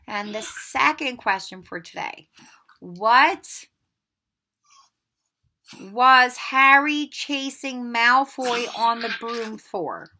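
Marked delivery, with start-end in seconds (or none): 2.8-10.1 slow. Shown as a demonstration. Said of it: "teacher reading quiz question".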